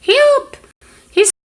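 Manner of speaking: high, loud panicked screams of help; shrieking for help. Shown as a demonstration. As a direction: scream for help so everyone hears.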